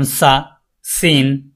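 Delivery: সা, সিন with a hissed s, very slow; slow s demo; slow s sound. Shown as a demonstration. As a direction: draw out the s. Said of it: "touch করবে".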